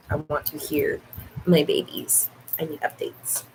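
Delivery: whispered, fading plea; soft pleading. Real, not acted. Streamer softly begging chat for updates. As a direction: trail off into a breathy ask.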